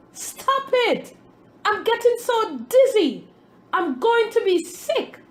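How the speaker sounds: high, loud panicked scream. A narrator's reading. Dough being mixed, screaming high and loud at the master. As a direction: shriek it out, dizzy and desperate. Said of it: "I screamed".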